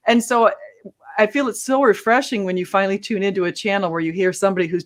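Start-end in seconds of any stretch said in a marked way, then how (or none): none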